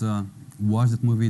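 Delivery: normal talking voice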